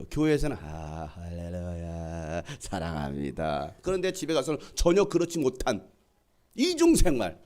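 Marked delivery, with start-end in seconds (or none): 0.6-3.8 low, slow, drawn-out holy voice; pious churchgoer act. Acted. Hypocritical parent being devout at church, put-on pious voice. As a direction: put on unctuous piety, very slowly. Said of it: "이중 생활하는 부모가 싫대요".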